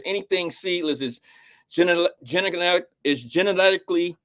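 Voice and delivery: words slurred by a retainer; tongue tripping over a retainer; wearing a retainer. Real, not acted. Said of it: "let me take this, my retainer out".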